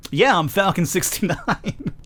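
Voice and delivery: slow, laughing through the name. Acted. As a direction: giggle through it. Laughs at own joke name.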